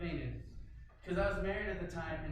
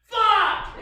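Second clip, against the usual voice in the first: much higher, much louder single curse; pained yell; 13 semitones higher, 15 dB louder than usual. Retold or imitated. Man in garage, yelling as his cut bleeds again. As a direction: shout it as the blood squirts again.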